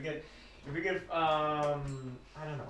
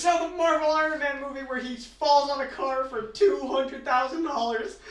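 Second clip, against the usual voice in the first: high, loud words through laughter; can barely get it out for laughing; laughing while joking; 9 semitones higher, 3 dB louder than usual. Real, not acted. Laughing joke about movie NFTs.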